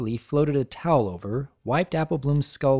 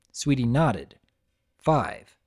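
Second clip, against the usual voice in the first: ordinary delivery, nothing marked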